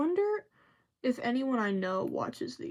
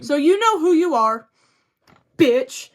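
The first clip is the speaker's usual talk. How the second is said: loud call-out to the bully; loud mock call-out; 6 semitones higher, 11 dB louder than usual. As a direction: shout it straight at her, louder. Calling out childhood bully.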